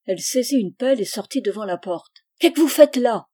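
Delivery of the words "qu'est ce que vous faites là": loud, high challenge to a stranger; furious, raised-voice demand; angry shout. Acted. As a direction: shout it out in anger at the intruder. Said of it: "cria-t-elle au vagabond".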